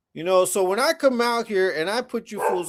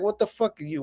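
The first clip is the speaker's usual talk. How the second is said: ordinary talk, nothing marked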